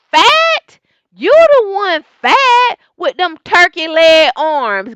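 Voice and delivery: high, loud indignant clapback; sassy comeback. Retold or imitated. Recapping Jackie's retort to Evelyn, high loud sass. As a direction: fire it back at her, offended and loud. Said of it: "Jackie looked at her and was like".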